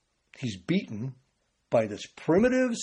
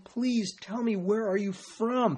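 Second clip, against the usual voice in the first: higher, softer, drawn-out pleading; fearful plea; 5 semitones higher, 5 dB quieter, slower than usual. Acted. Pilate pleading privately with Jesus, frustrated and afraid. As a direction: plead slowly with restrained, acted fear. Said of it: "Think of the fear in his voice".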